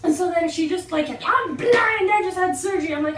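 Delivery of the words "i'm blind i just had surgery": raised, louder mock protest; indignant yell. Lady defending herself on the bus, mock-indignant. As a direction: imitate an aggrieved passenger protesting. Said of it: "started yelling at him".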